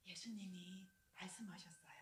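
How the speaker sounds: normal talking voice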